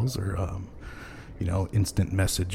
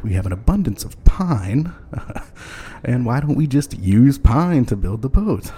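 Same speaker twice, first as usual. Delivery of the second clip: mock ancestor proposal; 5 semitones higher, 6 dB louder than usual. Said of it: "my great-great-grandfather Thomas said".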